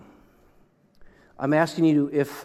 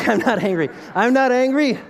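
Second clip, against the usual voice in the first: higher, louder laughing denial; laughing protest; 8 semitones higher, 5 dB louder than usual. Real, not acted. Pastor laughing off sounding angry, louder and higher.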